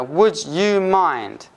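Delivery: "Would you mind?" drawn out very slowly, each word separate; slow stressed phrase. Shown as a demonstration. Teacher slowing down "Would you mind?" so students can hear it. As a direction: stretch the question out slowly. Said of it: "you have to think".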